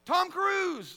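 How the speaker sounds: high, loud exclamation; mock yell. Preacher joking with congregation about substitute curse words, yelled. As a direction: shout the name as a joke swearword.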